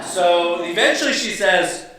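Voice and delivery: ordinary talk, nothing marked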